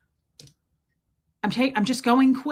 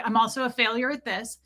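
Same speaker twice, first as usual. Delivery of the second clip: fast self-pitying rant; faster than usual. Retold or imitated. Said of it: "I was like".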